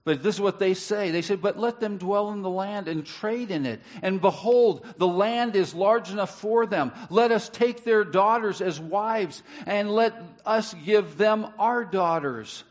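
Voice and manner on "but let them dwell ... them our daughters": high, loud proclaimed scripture; preaching. Acted. Preacher reading Genesis aloud to the congregation, forcefully. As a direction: read it out boldly to the church.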